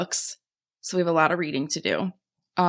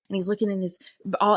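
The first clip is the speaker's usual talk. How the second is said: ordinary delivery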